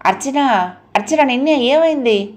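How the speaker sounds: worried, urgent question; alarmed. A narrator's reading. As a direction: call out to a friend in alarm. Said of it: "గాబరాగా".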